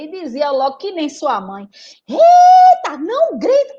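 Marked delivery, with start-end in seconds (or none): 2.1-3.8 high, loud scolding teacher; the teacher yells back at her; teacher shouting. Acted. Teacher shouting at Rita to stop yelling, high and loud. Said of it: "Já tava a professora gritando".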